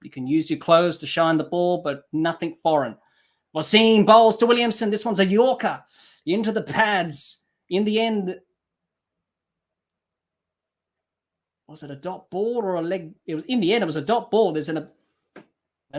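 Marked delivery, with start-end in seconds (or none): 3.6-14.5 live play-by-play. Acted. Commentator calls the ball live.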